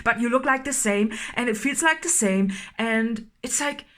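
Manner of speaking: usual speaking voice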